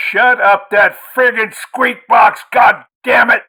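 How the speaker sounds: put-on furious drunken roar, high and loud; angry shout. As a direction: bellow it at the boy in rage. Drunk father bursts in yelling.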